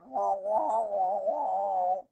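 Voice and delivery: muffled wah-wah adult noise; Charlie Brown teacher. Retold or imitated. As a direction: imitate wordless cartoon teacher talk. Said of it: "I'm like the teacher in the, in the Charlie Brown".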